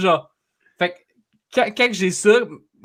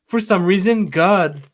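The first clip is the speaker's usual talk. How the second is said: higher, sing-song churchy message voice; imitating religious voicemail; 5 semitones higher than usual. Caller mocking an old woman's religious voicemail, sing-song. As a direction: bless the caller in a lilting tone. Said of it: "It's like".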